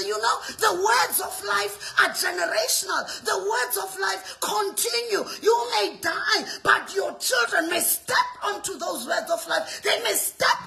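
loud, impassioned, driving sermon; rousing pulpit delivery with punched key words; full-force preaching. Acted. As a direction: preach with urgent, hammering conviction.

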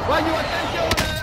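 loud, slow drawn-out warning; warning shout. Real, not acted. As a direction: call out to the guys, slow. Warning squad mid-fight.

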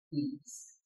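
plain reading voice

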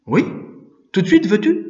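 high, loud, keen question; bright-pitched enthusiastic offer; eager suggestion. A narrator's reading. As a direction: propose it eagerly, pitch jumping up. Priest proposes starting at once.

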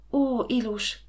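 usual speaking voice

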